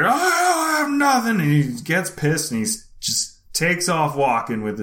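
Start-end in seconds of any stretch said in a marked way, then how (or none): 0.0-1.4 high put-on denial; thief denying. Shoplifter denying to the clerk, high mock voice. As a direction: snap back in a caricature voice. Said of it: "he's like".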